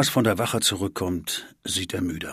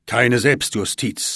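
firm; 4 semitones higher, 7 dB louder, slower than usual. Acted. Parents answer at once.

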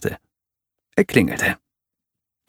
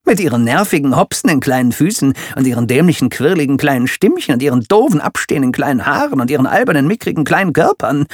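fast, breathless list of insults; scolding rant; 4 semitones higher, 4 dB louder, faster than usual. Acted. Villain listing what he hates about children, fast scolding. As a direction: rattle off the grievances quickly. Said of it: "schimpfte Ellen".

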